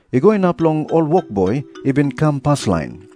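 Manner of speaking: normal talking voice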